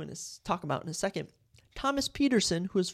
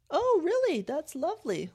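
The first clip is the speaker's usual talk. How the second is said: much higher, polite put-on mom voice; mock unenthusiastic mom; 10 semitones higher, 3 dB louder than usual. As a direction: imitate a mom being politely unimpressed. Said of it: "not that enthusiastic".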